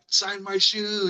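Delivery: put-on high, slow childlike plea; eager kid. A kid begging the visitor to sign his shoe, high and slow. Kids asking him to sign shoes.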